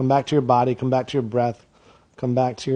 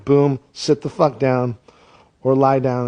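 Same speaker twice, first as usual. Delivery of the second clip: ordinary delivery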